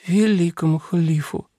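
sleepy mumbled correction; sleep talking. A narrator's reading. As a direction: mumble it half asleep. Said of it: "сквозь сон пробормотала принцесса".

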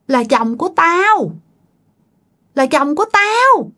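high, menacing spirit voice with long pauses; eerie, high-pitched taunting; creepy possessed voice. Retold or imitated. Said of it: "cái giọng anh hai thấy ghê lắm".

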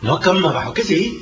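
loud scoffing disbelief; incredulous retort. Acted. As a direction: throw it back loudly, not believing it. Man snaps back in disbelief.

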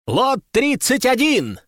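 high, drawn-out lot call; auctioneer's exclamation. A narrator's reading. Auctioneer announces the next lot, high drawn-out call. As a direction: announce it grandly to the hall. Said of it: "воскликнул распорядитель".

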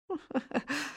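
short, quiet scoffing chuckle; a soft, sarcastic little laugh; mocking laugh. A narrator's reading. Captain sneering at the man he holds, mocking laugh. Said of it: "ضحك النقيب عمرو ساخرًا".

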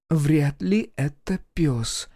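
slow, drawn-out doubting voice; voiced heroine weighing it up; doubtful woman character. A narrator's reading. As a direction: say it slowly, unconvinced. Doubting reply in voiced dialogue.

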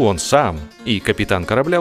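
ordinary delivery

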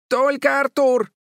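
very high, loud cry; exasperated shout. A narrator's reading. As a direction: shout it out to give in. Boy gives in, shouts demand. (громкий отчаянный выкрик)

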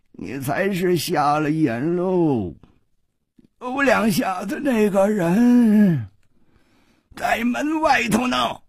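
slow, drawn-out taunt climbing high; high, leisurely scornful drawl; cool mockery. A narrator's reading. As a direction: drag each word out derisively. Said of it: "掌柜的冷冷地说".

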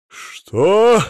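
high, loud outraged roar. A narrator's reading. Husband hearing mother-in-law stays a month, roaring. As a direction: bellow it, swooping up. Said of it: "взревел Сила Игнатьевич".

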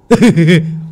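high, loud evil laugh; gleeful laugh. Acted. Servant Tuất laughing over the old man's death. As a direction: cackle with delight. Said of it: "sung sướng mà bảo".

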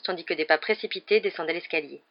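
ordinary delivery

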